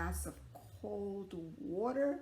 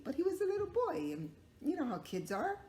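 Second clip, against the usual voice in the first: plain reading voice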